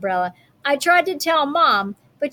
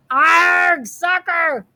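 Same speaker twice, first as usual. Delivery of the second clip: higher, louder crew echo; crew yelling; 6 semitones higher, 4 dB louder, much slower than usual. A narrator's reading. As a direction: yell it back as the crew. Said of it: "the crew yelled".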